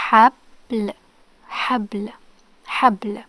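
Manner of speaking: "Habl" with b running straight into l; no vowel after b. Shown as a demonstration. Let's give it a try, "Habl" without vowel after b. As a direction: close "Habl" on bl, no vowel between them. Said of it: "no vowels after ba".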